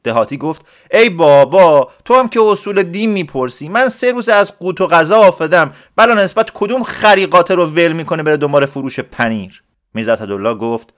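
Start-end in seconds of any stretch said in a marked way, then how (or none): 0.9-9.6 exasperated. Acted. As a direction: groan at the silly question. Villager exasperated by question.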